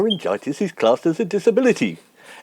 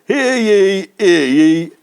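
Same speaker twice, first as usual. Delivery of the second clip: slightly raised demonstration cry; loud town crier call; 2 semitones lower, 3 dB louder, much slower than usual. Shown as a demonstration. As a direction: model a town crier's proclamation. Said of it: "as loud as you can".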